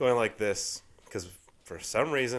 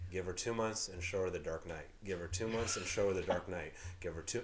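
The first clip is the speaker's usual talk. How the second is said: inner voice; 6 semitones lower, 9 dB quieter than usual. Retold or imitated. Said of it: "in my head, I'm like this".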